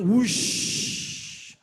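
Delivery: loud, long airy whoosh. Acted. The summoned jinn arrives from the tree, loud airy whoosh. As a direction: imitate a jinn rushing in with a voiceless whoosh.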